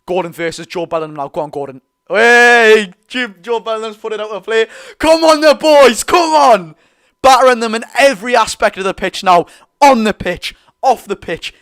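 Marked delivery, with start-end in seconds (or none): 2.1-6.8 loud, high, slow shout at the players; excited yell. Real, not acted. Fan yelling at the match. 7.2-11.5 fired-up fan. Real, not acted.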